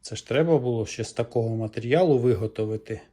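ordinary delivery

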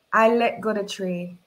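usual speaking voice

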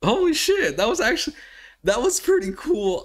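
high-pitched, thrilled outburst; real excited amazement; amazed excitement. Real, not acted. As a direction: blurt it out high and loud, astonished. Amazed reaction to quarterback play.

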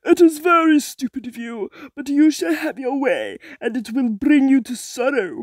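sneering hag voice; sea witch voice. Acted. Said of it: "said the sea witch".

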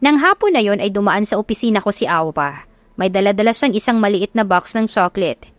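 ordinary talk

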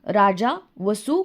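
usual speaking voice

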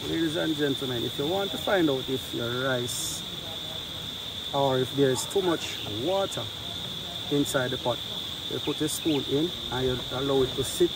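ordinary delivery

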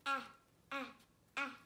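short, separate "Ah, ah, ah" for the letter A; short A sound. Shown as a demonstration. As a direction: say "Ah" as a short phonics vowel, three times.

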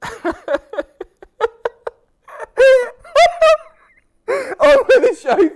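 slow, high and loud, giggling through the words; laughing. Real, not acted. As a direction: try to move on but keep laughing. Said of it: "I'm, I'm crying".

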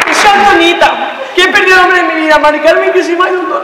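high, loud anguished wail; hammed-up heartbroken lament; melodramatic wailing. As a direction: wail out the loss to the therapist. Acted breakdown in therapy.

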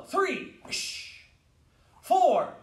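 high, loud count; shouted drill count. Real, not acted. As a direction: call it out sharply for the class. Calling counts for block drill.

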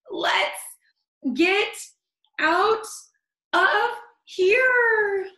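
high, loud, strained, word by word; loud strained cry, one word at a time; choking escape cry. Acted. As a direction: gasp it out as you flee the cloud. Choking burglars flee.

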